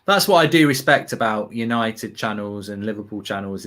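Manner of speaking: usual speaking voice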